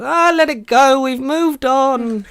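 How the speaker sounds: high, slow mock Matt Smith voice; drawn-out high put-on Doctor voice; Matt Smith impression. Podcaster mimicking Matt Smith's Doctor, high and slow. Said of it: "Matt Smith goes".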